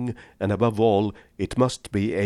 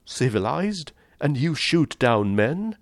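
high, rising disbelieving questions; incredulous challenge; 7 semitones higher than usual. A narrator's reading. Rainsford challenges the General's civilized claim, incredulous. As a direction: throw it back, pitched up in disbelief.

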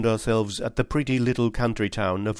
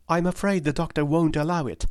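put-on higher, pleading young lady's voice; earnest young woman; 7 semitones higher than usual. Miss Turner insisting to Holmes that James is innocent, higher earnest voice. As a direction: plead for James with eager conviction.

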